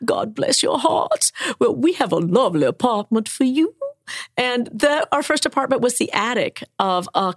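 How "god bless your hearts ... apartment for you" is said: higher, sugary Southern church lady; Southern sponsor accent. Retold or imitated. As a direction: gush like a kind Southern sponsor. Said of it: "all who talk like that".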